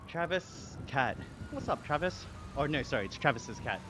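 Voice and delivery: usual speaking voice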